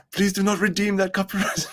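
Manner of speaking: high mock Indian accent, near laughing. Streamer joking about copyright claims, pleading high Indian accent. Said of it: "Indians are funny".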